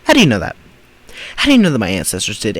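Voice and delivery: loud, sharp challenge; angry demand. Real, not acted. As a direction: fire the question back hard. Angry reply to clip.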